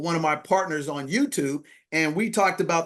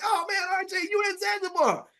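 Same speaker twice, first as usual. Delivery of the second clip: retold excited greeting, pitched way up; high-pitched, thrilled outburst; really excited. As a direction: exclaim to a friend in amazed delight. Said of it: "So I'm really excited".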